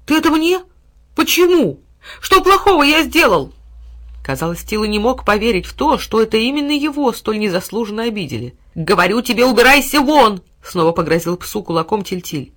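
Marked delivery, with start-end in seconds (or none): 0.0-3.5 high, loud wounded dog; hurt dog. A narrator's reading. Dog hurt and bewildered by his master, high and loud. 8.7-10.4 louder, higher angry order; angry boy. A narrator's reading. Boy ordering the dog out again, loud and angry.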